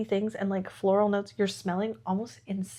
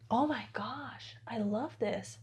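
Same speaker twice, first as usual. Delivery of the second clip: whisper; 6 dB quieter, slower than usual. Real, not acted. Delighted sniff, whispered.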